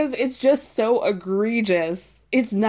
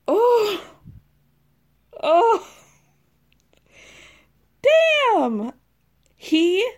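high, loud shocked exclamations; stunned; 11 semitones higher, 4 dB louder, much slower than usual. Real, not acted. Vlogger reeling at Joel's tequila insult, stunned. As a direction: let the shock burst out.

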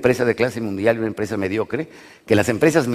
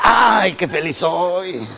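groaning; 5 semitones higher, much slower than usual. Retold or imitated. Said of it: "hasta cuando es feliz, puja".

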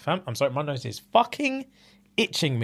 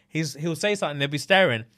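normal talking voice

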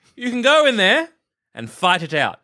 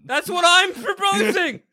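high, drawn-out exasperated cry; slow, high-pitched exasperation; exasperated outburst; 11 semitones higher than usual. Acted. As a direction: burst out in frustration, pitched way up. Exasperated in acted negotiation.

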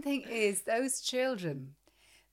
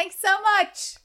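much higher, louder gushing thanks; mock over-friendly thanks; 9 semitones higher, 10 dB louder, slower than usual. Mocking his co-host's cheerful thanks, high and loud. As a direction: overdo the cheerful thank-you. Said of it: "The way you thank people".

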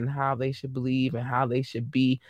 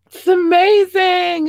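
much higher and louder, slow, burst of delight; excited exclamation; 9 dB louder, slower than usual. Real, not acted. Host to viewers, bursting with loud delighted excitement. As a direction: belt it out like you can't believe it.

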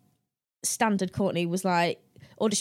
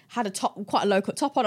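ordinary talk, nothing marked

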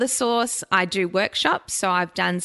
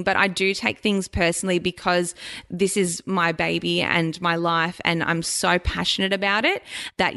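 usual speaking voice

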